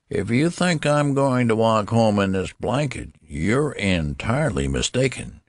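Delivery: put-on high-pitched old-man character voice; indignant old man. A narrator's reading. Old newborn refusing to go home in blanket, indignant. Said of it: "the old man announced suddenly".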